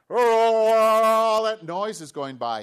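loud, high, drawn-out bellow; long, high cry of alarm; blind man shouting. Acted. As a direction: wail it out over the crowd. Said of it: "he's kinda like".

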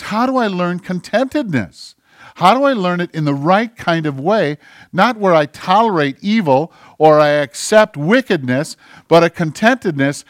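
sermon delivered loud and earnest; full-force preaching. Acted. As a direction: drive each question home to the congregation.

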